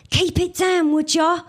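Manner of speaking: sharp high hissed complaint at the bin lorries. A narrator's reading. Whitney hisses at the bin lorry drivers, sharp and high. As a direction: hiss it up at the noisy bin trucks. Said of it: "hissed Whitney".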